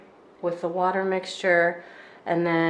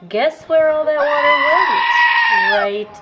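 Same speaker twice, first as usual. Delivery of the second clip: sarcastic; 9 semitones higher, 9 dB louder, much slower than usual. Real, not acted. Sarcastic complaint about spilled water.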